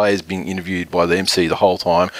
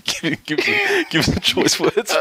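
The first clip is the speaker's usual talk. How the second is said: high, pleading give give give; pretend pleading; 10 semitones higher, much slower than usual. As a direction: beg him for the quote, voice leaping up. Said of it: "and just say".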